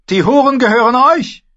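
high, loud grand announcement; loud proclamation. Acted. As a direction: proclaim it generously. (laute Verkündung)

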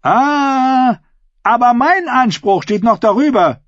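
high, ringing retort; projected, high-pitched public voice; bold claimant. A narrator's reading. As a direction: top their argument in front of everyone. Claimant answers before the crowd.

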